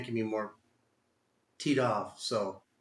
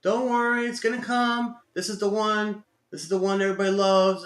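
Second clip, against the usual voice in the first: mocking other traders; 5 semitones higher, 5 dB louder than usual. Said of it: "people all on Stock Twitch saying".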